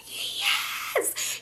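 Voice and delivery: high whispered cheer; secretly excited. Retold or imitated. Privately glad he went to jail, an excited whispered yes. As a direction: whisper a drawn-out, gleeful yes. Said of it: "I wasn't excited this man went to jail, but a part of me kinda was".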